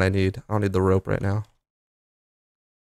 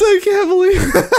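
high laughing disbelief. Real, not acted. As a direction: laugh through the words. Laughing with joy.

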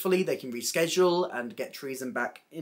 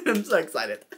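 laughing; 7 semitones higher, 3 dB quieter than usual. Real, not acted. Giggling over favourite drag queens.

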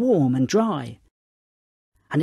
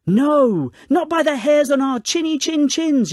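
loud, high storybook pig yell; defiant yell; 10 semitones higher, 6 dB louder than usual. A narrator's reading. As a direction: yell a refusal at the wolf. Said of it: "yelled the three pigs".

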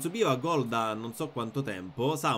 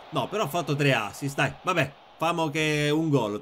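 ordinary talk, nothing marked

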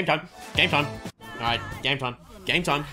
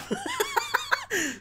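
high run of giggles; helpless high laugh; real laughter. Real, not acted. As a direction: crack up in high-pitched laughter. Cracks up after Overwatch time.

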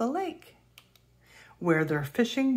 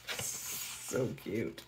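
whispered aside; 7 semitones lower, 11 dB quieter than usual. Real, not acted. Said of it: "reader's aside".

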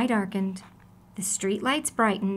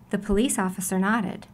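ordinary delivery

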